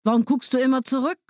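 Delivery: put-on impatient father snapping at the boy; scolding father. A narrator's reading. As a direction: hurry him along, irritated. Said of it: "schimpfte der Vater".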